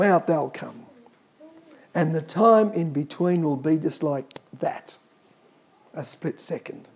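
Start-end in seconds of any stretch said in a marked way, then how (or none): none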